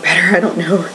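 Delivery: ordinary delivery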